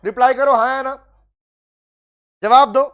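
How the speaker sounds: loud call for answers; urging the class. Real, not acted. Teacher calling out to online students for answers, loud. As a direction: push the students to reply now.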